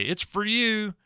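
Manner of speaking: "It's for you" with for said as fur, high and loud; southern fur. Shown as a demonstration. Teacher mimics a Southern phone call, for said as fur. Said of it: "do you say".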